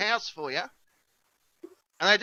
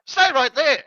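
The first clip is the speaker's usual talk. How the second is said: urgent command; 6 semitones higher, 7 dB louder, slower than usual. Retold or imitated. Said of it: "she's like".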